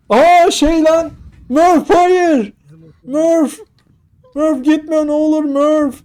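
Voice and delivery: high, drawn-out mock-anguished cries; hammed-up desperate plea. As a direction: beg Murph to stay, in mock despair. Acting out Interstellar's plea to Murph. (abartılı, yalvaran feryat)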